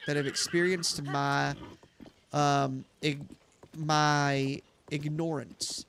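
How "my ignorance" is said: ordinary talk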